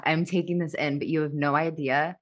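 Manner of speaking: ordinary talk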